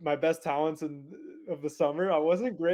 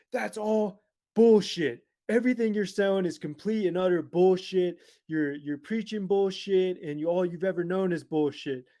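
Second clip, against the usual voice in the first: imitating a furious man, raised pitch and volume; livid retold rant; 4 semitones higher, 5 dB louder than usual. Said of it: "freaking screaming at me, livid".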